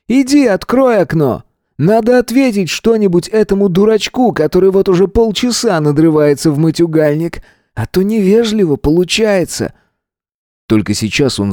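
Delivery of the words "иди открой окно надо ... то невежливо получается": high-pitched, sardonic commanding voice; mocking order. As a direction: order them about with exaggerated sarcasm. Gunman mockingly orders student around.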